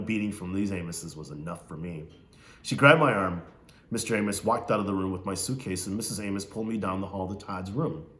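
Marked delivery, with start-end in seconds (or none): none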